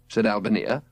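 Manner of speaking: ordinary delivery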